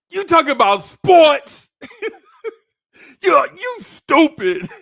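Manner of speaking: high, loud, laughing through the words; a little girl cracking up at him; mocking laugh. [name] laughs at crying boy, high mocking laugh.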